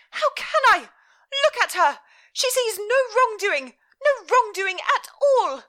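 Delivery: very high, loud, frantic mother; hysterical mother. Acted. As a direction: cry out in despair at her daughter. Mother's voice in story.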